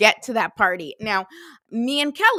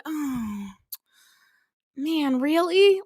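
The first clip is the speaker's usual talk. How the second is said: deflated; 5 semitones higher, much slower than usual. Pretend. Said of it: "you completely get deflated".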